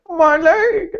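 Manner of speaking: high-pitched cartoon yell; mock pained scream. Injured extra off screen as a joke, mock pained scream. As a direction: yell it high as a running gag. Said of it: "if there was a joke in there".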